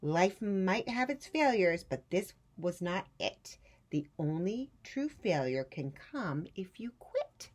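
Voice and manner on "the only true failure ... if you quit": usual speaking voice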